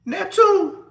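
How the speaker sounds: high, surprised call of the name; calling out in recognition. Retold or imitated. Old sergeant spots him at intake, calling his name high. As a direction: shout the name like spotting an old face.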